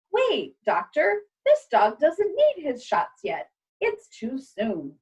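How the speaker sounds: high, slow, insistent nurse's voice; urgent nurse. Acted. Nurse stops the shots.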